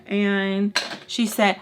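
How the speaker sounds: normal talking voice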